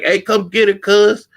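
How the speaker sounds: imitated guy calling to come get her; imitating a pleading dude. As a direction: beg the friend to pull her off. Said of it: "So he was just like".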